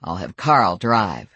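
low, slow, dry; deadpan, unhurried. A narrator's reading. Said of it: "he told me dryly".

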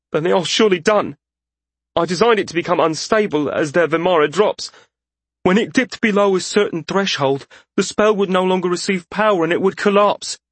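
high, insistent character voice; troubled journeyman mage. Acted. Journeyman explains the failed spell.

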